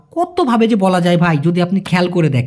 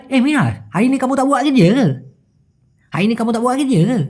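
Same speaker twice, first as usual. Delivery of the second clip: quick, higher, slurred Malaysian slang; Malaysian drawl; 4 semitones higher, faster than usual. Shown as a demonstration. Teacher contrasting how Malaysians say it, in slang drawl. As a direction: run it together in Malaysian slang. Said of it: "যদি কিনা ওরা টানে বলে".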